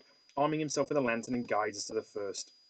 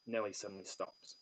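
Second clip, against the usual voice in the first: ordinary delivery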